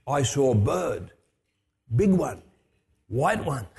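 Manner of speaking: slow, halting broken English, lightly imitated; a hint of an old Solomon Islander retelling what he saw; mild imitation of a gravelly islander, slow and simple; Apollos's broken English. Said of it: "with his broken English and his gravelly voice".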